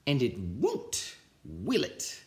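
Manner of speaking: slow, menacing scratchy voice; slow threat. Acted. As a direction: lean on the class. Swamp threatening class.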